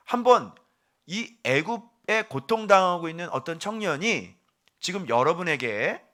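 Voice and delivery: ordinary delivery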